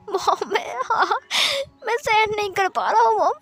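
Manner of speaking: high, crying pleas through the pain; sobbing in pain. Acted. Son sobbing to his mom that he cannot bear it. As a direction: beg through sobs, barely coping.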